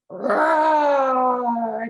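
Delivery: long loud growled roar; bear roar. A narrator's reading. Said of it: "the old bear growled".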